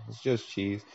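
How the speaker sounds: quiet, flat letdown; deflated. Retold or imitated. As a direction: sigh it out, unimpressed. Disillusioned mouse tastes cheese.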